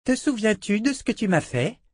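high, mocking little-girl voice; cruel child. A narrator's reading. Child princess taunting her captive Richard, high sneering voice. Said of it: "demanda-t-elle à Richard".

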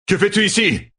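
high-pitched, demanding yell; shout. A narrator's reading. Rand challenges the dark one overhead, shouting. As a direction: cry out in alarm at the sky. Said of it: "cria-t-il".

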